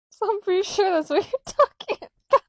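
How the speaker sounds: high, loud, gleeful rush; giggly excitement. Real, not acted. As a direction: let the voice shoot up with amusement. Excited talk about Burke, laughing.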